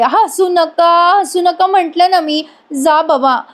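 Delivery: high, drawn-out mock whine; imitated tearful protest, stretched out; near-tears sulk. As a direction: whine it like a wife about to cry. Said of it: "Nandini आता रडायला लागते".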